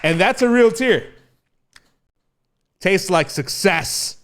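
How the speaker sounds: booming podcast host introducing the show; grand host intro. Acted. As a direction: announce yourself like a big showman.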